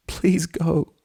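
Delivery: high, pained, pleading voice; begging. A narrator's reading. Twilight locked in embrace urging Spike to leave, begging. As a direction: beg while holding back the pain. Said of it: "Twilight begged".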